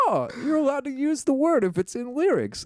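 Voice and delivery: high, chirpy naive voice. Retold or imitated. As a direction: sound thrilled and clueless. Naive self mocked, high voice.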